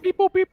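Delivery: loud, high mechanical beep-boop; robot beeps. Pretend. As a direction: beep like an eager little drone. GM mimics the pups' beeps.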